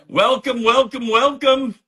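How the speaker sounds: high, loud triple welcome; hearty welcome. Real, not acted. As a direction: call out a big greeting to arrivals. Host greets arrivals in the chat.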